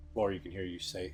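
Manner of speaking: ordinary delivery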